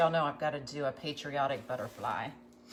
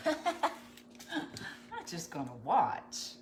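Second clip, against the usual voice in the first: laughing; 3 dB quieter, much slower than usual. Real, not acted. Laughs at failed pronunciation.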